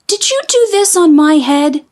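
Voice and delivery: high, earnest little mole; little mole asking. A narrator's reading. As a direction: ask it like a small puzzled mole. Mole asks the pigeon.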